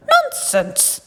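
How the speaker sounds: high shout of scorn. Acted. Prince shouting at Wonka, high scornful shout. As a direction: scoff it out loudly. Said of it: "shouted the prince".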